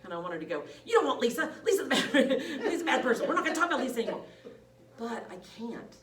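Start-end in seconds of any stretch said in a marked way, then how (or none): 0.8-4.2 loud, high, laughing protest; mock outburst. Nurse wanting to warn off the client, loud mock outburst. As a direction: blurt it out loud with a laugh breaking in. Acts out the outburst she held back.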